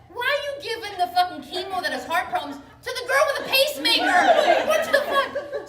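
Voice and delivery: high, loud outburst building to a yell; comic outrage, voice climbing; incredulous protest. Retold or imitated. Acted protest at risky chemo.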